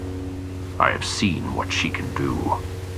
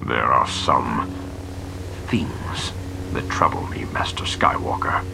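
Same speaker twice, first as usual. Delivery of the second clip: troubled whisper; 6 semitones lower than usual. Acted. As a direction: whisper it as if ashamed. Talon chin dropped.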